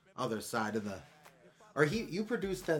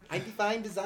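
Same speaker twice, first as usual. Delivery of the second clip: higher pitched, playful; higher-pitched take; 8 semitones higher than usual. Shown as a demonstration. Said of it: "That sounds kinda cool".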